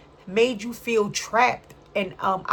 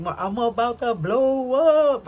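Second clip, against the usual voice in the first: hyped brag; 5 semitones higher, 5 dB louder, slower than usual. Retold or imitated. Imitating hyped gossip quote.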